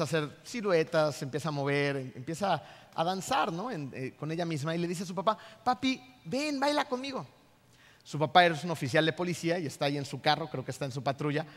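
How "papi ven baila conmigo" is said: put-on higher, childlike voice asking to dance; little girl's plea. Girl calling her father to dance with her, childlike pleading. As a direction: coax daddy like a ten-year-old girl.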